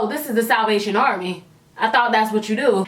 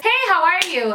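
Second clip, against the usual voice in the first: nicer-than-I-am, higher-pitched greeting; put-on nice voice; Angelica voice; 5 semitones higher than usual. Shown as a demonstration. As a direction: greet extra nicely, pitched up. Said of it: "that's my Angelica voice".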